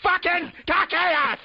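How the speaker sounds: very high, very slow squeal; squeaky silly voice. Pretend. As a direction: drag it out in a shrill cartoon squeak. Silly outburst, co-host laughs.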